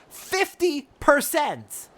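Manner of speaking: Fifty percent! slow, high and loud, falling hard; outraged. Acted. Frey throws the merchant's demand back at him, slow outrage. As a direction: spit each word out in fury.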